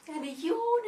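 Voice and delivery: loud high stretching yawn; big yawn. Acted. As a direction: yawn awake theatrically. Goldilocks wakes and yawns.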